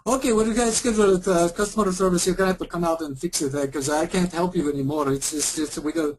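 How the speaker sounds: fast, high put-on Eastern European accent; mock agent brushing the caller off in an Eastern European accent; hammed-up Eastern European accent; bad Eastern European accent. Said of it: "really bad Indian and Eastern European accent".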